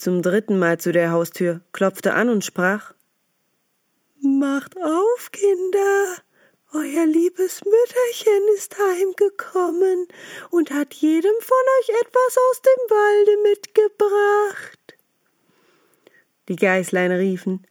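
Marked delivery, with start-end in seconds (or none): 4.2-15.0 much higher, pleading fake-mother voice with a whimper; the wolf whining sweetly like a tearful mother; wolf faking mother. Wolf at the door pretending to be the mother, high and whimpering. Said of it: "seine Stimme fein".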